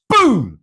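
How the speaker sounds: high, falling "boom" for a blast; storyteller's exploding-door sound effect; imitated explosion boom. A narrator's reading. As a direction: punch out a sudden high boom.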